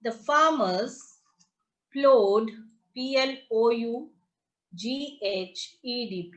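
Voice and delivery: ordinary delivery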